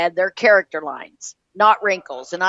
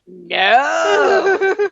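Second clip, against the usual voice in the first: exasperated; 7 semitones higher than usual. Real, not acted. Exasperated rant punchline.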